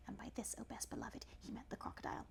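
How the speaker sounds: soft unvoiced narrator aside; whispered aside. A narrator's reading. Narrator whispering an aside to the listener. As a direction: whisper it to the best beloved.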